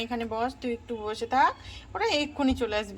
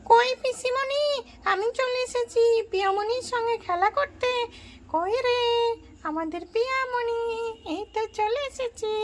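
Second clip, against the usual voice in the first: put-on high eager little girl arriving to play; child voice; 10 semitones higher than usual. Babli calling for Piya, high child voice. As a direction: lift the voice up like an excited young cousin.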